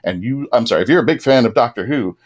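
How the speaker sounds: normal talking voice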